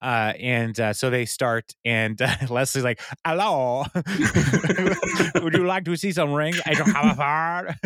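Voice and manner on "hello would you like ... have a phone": high, giggly mock French accent; hammed-up French accent, pitched up; put-on French accent. Leslie playing a French shopper on camera, mock French accent. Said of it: "Why do you have an accent?".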